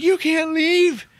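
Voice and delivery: high, loud mock tantrum; drawn-out squealed outburst, imitating a kid; freaking out. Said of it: "freaking out on her".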